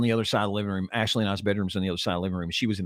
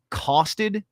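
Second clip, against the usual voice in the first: incredulous; 8 semitones higher than usual. Retold or imitated. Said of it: "And I went".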